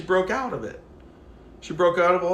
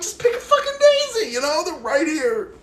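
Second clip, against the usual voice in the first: much higher, louder frustrated yell; exasperated at a stranger who won't pick a daisy, yelling high and loud; 12 semitones higher, 8 dB louder than usual. Retold or imitated. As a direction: burst out at her to just do it. Exasperated urging.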